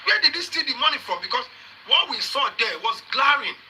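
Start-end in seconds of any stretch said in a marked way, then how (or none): 0.0-1.2 "Where did he steal the money from?" pushed out in a higher pitch; insistent question. Real, not acted. Host demanding where he stole the money from, insistent. Presses the same question a third time.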